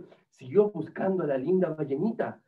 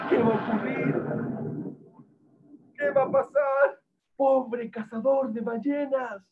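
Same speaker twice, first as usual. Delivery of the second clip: storyteller's raised, anxious wail; dramatic worry; 8 semitones higher than usual. A narrator's reading. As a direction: fret aloud over the hero's fate. Narrator frets for the hunter.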